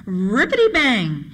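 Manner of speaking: slow, drawn-out exclamation with a big pitch swoop; storyteller's dramatic burst; excited sound word. Acted. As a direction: draw out the bang like a sudden racket. Acted sound of alarm going off.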